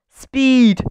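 loud, high exclamation; excited speedster. A narrator's reading. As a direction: call it out with gusto. Speedster shouts his hobby.

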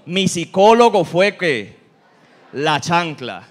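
slowed, loud, the saying drawn out word by word; drawn-out proud boast; slow emphatic saying. Retold or imitated. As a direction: deliver the proverb slowly, with weight. Quoting the old saying of parents.

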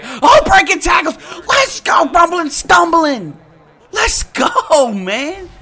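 high, loud hype yell; real excited shout as he breaks tackles; excited cheering. Real, not acted. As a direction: cheer the runner on at full volume. Cheering a touchdown run.

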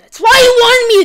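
loud indignant shout; outraged shout. Real, not acted. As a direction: yell it as the trick sinks in. Realising he was set up.

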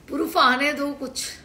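ordinary talk, nothing marked

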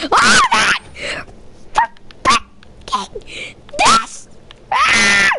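sudden high shriek of surprise; startled shriek. Real, not acted. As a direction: yelp it out, caught off guard. Startled during the game.

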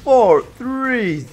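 lightly voiced arena announcer; game announcer voice. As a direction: call the countdown like an announcer.

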